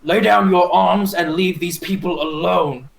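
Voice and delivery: mildly stern heroic warning; intimidating command. Acted. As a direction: order them to back down, lightly intimidating. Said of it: "make that intimidate".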